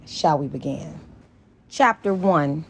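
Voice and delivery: usual speaking voice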